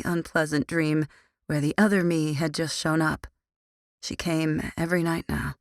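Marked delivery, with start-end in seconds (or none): none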